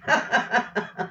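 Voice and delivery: short high chuckle; laugh. Real, not acted. Vlogger amused by old age remark, short high laugh.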